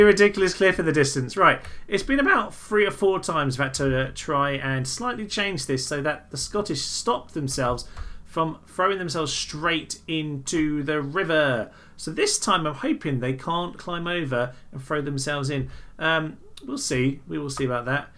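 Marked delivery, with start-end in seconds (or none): none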